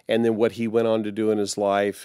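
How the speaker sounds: ordinary delivery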